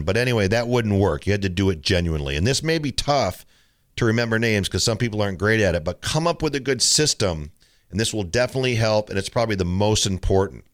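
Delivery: ordinary talk, nothing marked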